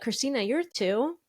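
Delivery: normal talking voice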